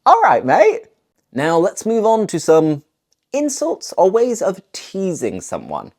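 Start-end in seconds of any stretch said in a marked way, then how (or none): 0.1-0.9 All right, mate called out higher and louder; cheery greeting. Shown as a demonstration. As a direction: call "All right, mate" brightly across the pub, much higher. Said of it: "Mate just means friend".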